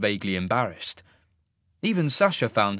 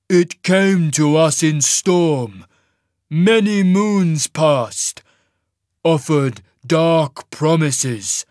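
very slow, loud, booming giant; rumbling giant; 6 semitones higher, 8 dB louder, much slower than usual. A narrator's reading. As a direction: heave out each phrase like an avalanche. Giant's character voice.

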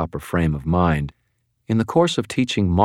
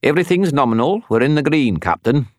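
put-on higher, faster Scottish accent; 9 semitones higher, 4 dB louder than usual. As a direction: put on a Scottish accent, Star Trek engineer style. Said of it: "affecting a Scottish accent".

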